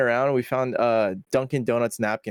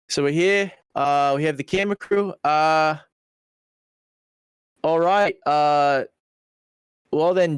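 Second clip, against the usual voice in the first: a mock Australian accent, casual and breezy; 3 semitones higher, slower than usual. Retelling how the Australian filmmaker told them to do tricks, mock Australian accent. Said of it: "like, Australian".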